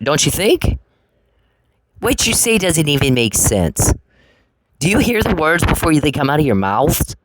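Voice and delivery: ordinary talk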